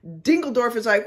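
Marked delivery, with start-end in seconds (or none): 1.0-1.1 long high whoop; mock scream. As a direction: imitate a buddy's wild scream.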